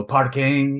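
slow English word with a Portuguese accent. Shown as a demonstration. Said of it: "a Portuguese accent on it".

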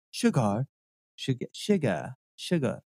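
sugar repeated softly with a British R-ish ending. Retold or imitated. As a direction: try the British sugar several times. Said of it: "Is there an R at the".

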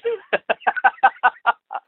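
high real laugh. Real, not acted. Rip laughing after telling the plane prank. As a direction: laugh hard at the memory.

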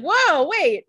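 high, loud mock alarm; acted startled halt; sudden realization.

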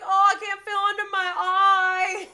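high, loud mimicked wail; hammed-up crybaby whine over a hurt eye; mock complaining. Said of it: "complaining about it".

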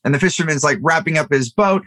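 ordinary talk, nothing marked

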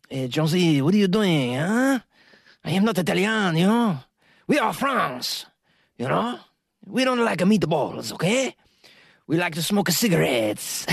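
high, hammed-up French accent; mock French accent. Patrons correcting Jonesy that they are French, mock French accent. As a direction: put on an indignant cartoon French accent. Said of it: "we find out that they're French. They're like".

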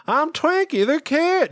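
very high, slow cartoon voice; cartoon mascot voice. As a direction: squeak it like a mascot. Voicing Twinkie the Kid mascot.

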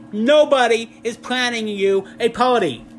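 loud, emphatic, stressing nobody; firm insistence. Retold or imitated. Husband shaking his wife awake, loud firm insistence. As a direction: drive it home to wake her.

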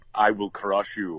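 put-on slow, heavy Russian accent; menacing Russian boxer voice; brainless Russian. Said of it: "Brainless Russian".

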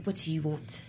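quiet, imitating a London accent. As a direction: mimic his soft London accent. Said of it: "in his London voice".

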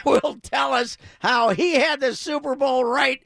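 lively intro with a laugh in it; radio announcer read. Acted. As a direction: hype the guest with a grin. Host introduces guest.

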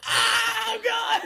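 squealed high shock; shocked oh god. Retold or imitated. Retelling her reaction to the movie scene, high shocked squeal. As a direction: cry it out high and loud in disbelief. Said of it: "And I'm like".

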